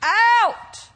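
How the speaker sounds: drawn-out, ringing proclamation; commanding cry. Acted. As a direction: call out each word as a summons. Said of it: "This says".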